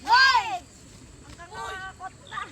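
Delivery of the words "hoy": loud sharp hey; loud shout. Real, not acted. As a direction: shout to stop them. Shouting to get attention.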